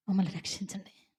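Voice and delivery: hushed begging whisper; whispered plea. Retold or imitated. Lot's family whispering to the angels to save them.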